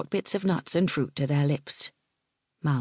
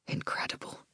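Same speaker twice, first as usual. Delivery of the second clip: whispered; 10 dB quieter than usual. A narrator's reading. Malgwyn's awed inner thought.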